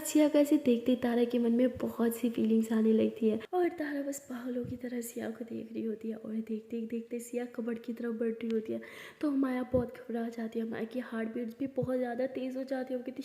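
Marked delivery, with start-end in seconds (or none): 4.6-13.2 hushed, breathless storytelling whisper; whispered narration. A narrator's reading. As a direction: whisper the suspense to the listener.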